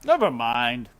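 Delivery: lightly voiced king; king dismissing. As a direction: wave it away like a king. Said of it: "said the king".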